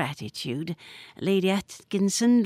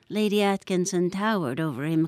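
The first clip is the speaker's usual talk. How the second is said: usual speaking voice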